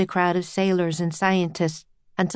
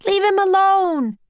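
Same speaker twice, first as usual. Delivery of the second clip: high, loud protesting yell; 12 semitones higher, 5 dB louder than usual. A narrator's reading. Annie defending the octopus from sailors, high yell. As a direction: yell it high in a young girl's voice. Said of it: "yelled Annie".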